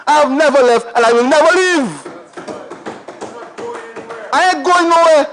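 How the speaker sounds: light laughing vow; laughing declaration. Real, not acted. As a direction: chuckle while promising to stay. Declaring loyalty to constituency.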